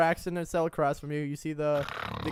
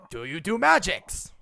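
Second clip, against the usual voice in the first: goblin voice; 4 semitones higher, 6 dB louder than usual. Acted. GM repeats goblin question.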